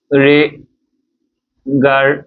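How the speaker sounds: regarder broken into slow, separate syllables; syllable-by-syllable demo. Shown as a demonstration. Teacher sounding out the example for French learners, slow syllabic regarder. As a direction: say regarder very slowly, ending in an A sound. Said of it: "this accent is pronounced as A".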